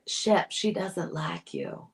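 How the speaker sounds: a light drawled Southern impression of Madison; Southern accent. Quoting the castmate to Shep in a mild Southern accent. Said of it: "she has the Southern accent with it".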